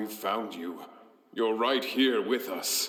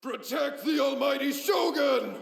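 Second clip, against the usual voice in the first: battle shout. Acted. Samurai yells to his comrades to guard the Shogun, full battle shout. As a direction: bellow the command across the field.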